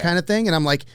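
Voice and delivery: normal talking voice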